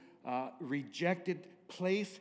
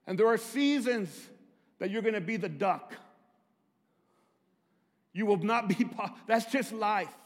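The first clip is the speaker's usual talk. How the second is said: preaching at full force; 7 semitones higher, 6 dB louder than usual. Acted. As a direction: preach it out loud, the cross as the climax. Pastor preaching on life's seasons.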